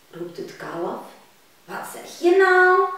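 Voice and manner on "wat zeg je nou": high, loud puzzled calf. Acted. Calf questioning its mother, high and surprised. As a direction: squeak it like a puzzled little calf.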